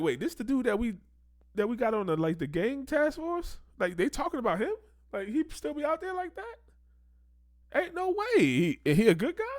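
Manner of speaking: high-pitched disbelief, imitating baffled police; mock incredulous. Commentator plays police learning about the informant, high incredulous mock voice. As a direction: act astonished, voice climbing high. Acts out police hearing the gossip.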